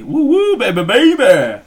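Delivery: put-on high, loud woo woo then slow baby, baby; hammed-up radio hype; hyped radio host. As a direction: whoop it up like a shock jock. Hyping himself as Howard Stern.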